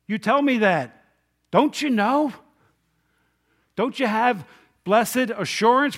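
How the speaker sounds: loud, high-pitched preaching; impassioned, incredulous pleading; insistent challenge. Real, not acted. Challenges congregation who doubt their salvation.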